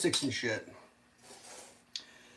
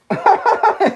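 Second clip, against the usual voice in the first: high laugh; 11 dB louder than usual. Real, not acted. Laughing at the show.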